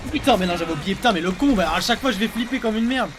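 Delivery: loud, rattled outburst; startled yell. Real, not acted. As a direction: blurt it out shaken. Startled by monster again.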